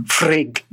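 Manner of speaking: Frigg with a rolled trilled R; Frigg, R rolled a little too much. Shown as a demonstration. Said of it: "her name would be pronounced more like".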